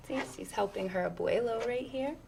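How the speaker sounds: plain reading voice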